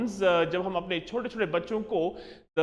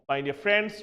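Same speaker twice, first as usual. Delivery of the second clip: normal talking voice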